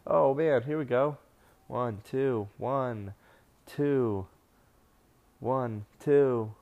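loud, rising excited near-fall count. Real, not acted. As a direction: count along fired up. Live count of near falls.

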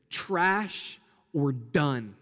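"trash or done" dragged out slowly; preacher hammers the new translation; slow emphasis. Shown as a demonstration. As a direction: let each word land, very slow. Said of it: "more accurate translation".

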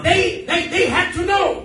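high, loud preacher's proclamation; full-force preaching. Real, not acted. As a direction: declare it forcefully to the congregation.

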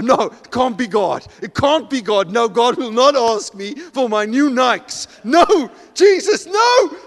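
high, loud, pleading protest that climbs into a shout; hammed-up terror, slow and shouted at the end; panicked refusal. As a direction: beg him off in rising comic alarm. Man refuses God, acted panic.

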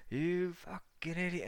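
high-pitched mock fury; retold outraged yell; imitated angry friend. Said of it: "I can hear him".

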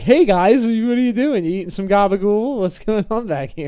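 high, loud, nosy mock friendliness; mock-innocent busybody at the mob table; clueless intruder. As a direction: barge in all cheery and oblivious. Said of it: "be like".

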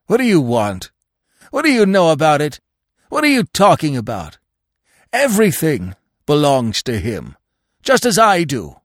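yelping. A narrator's reading. Dog defending his master to the animals, agitated yelping. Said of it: "he yelped".

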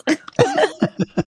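a high giggle; real high-pitched giggle; laughing. Real, not acted. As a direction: giggle at the memory. Laughing at the memory.

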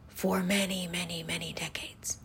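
unvoiced whispered trail; hushed fading whisper. Real, not acted. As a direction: drop to a whisper for emphasis. Drops to whisper.

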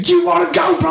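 high, shouted mock threat; drunk British bloke picking a fight. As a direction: yell like a drunk fan wanting to fight. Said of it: "everything went to fucking".

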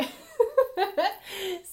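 high burst of real laughter. Real, not acted. Telling viewers about the man, bursts out laughing. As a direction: laugh out at how crazy it was.

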